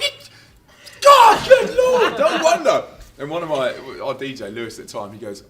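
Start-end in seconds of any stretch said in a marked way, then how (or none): 0.0-2.8 very high, loud, slow laughing cry; exasperated outburst. Retold or imitated. Singer realizing the crowd was told not to dance, slow loud laughing outcry. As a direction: drag it out in disbelief, half laughing.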